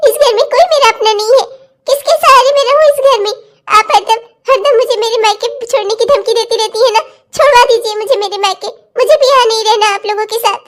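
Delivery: hurt, sobbing complaint; crying. Acted. Daughter-in-law saying nobody here is hers, crying. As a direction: let the words shake with sobs. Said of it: "रुला दिया ना बेचारी बच्ची को".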